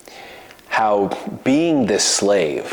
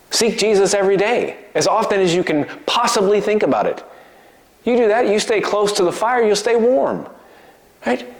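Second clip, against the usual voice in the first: preaching at full force; 9 semitones higher than usual. Acted. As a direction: urge the church on, full force. Preaching climax on staying close.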